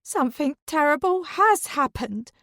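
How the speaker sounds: high, loud, indignant retort; high, loud, offended rebuke; aghast. A narrator's reading. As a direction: fling it back at her husband. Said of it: "Dawn looks aghast".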